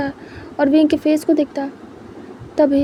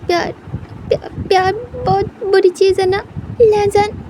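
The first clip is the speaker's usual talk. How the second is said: higher, broken, tearful question; crying softly; 6 semitones higher than usual. Acted. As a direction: stammer out the words through tears. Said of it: "wing रोते हुए बहुत cute लग रहा था".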